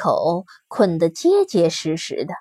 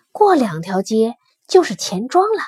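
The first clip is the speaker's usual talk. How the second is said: ordinary delivery, nothing marked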